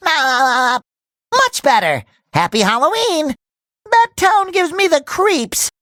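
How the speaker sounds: ordinary talk, nothing marked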